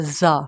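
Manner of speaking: زا with a hard buzzing z, held; firm, drawn-out زا; hard letter sound. Shown as a demonstration. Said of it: "زا کو hard پروناؤنس کیا جاتا ہے".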